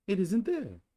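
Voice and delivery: high Pooh bear voice. Acted. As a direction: state it plainly and brightly.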